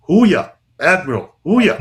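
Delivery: loud, high Navy hooyah chant; barked hooyah. Pretend. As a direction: bark it like a rallying cry. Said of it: "And, and I would say".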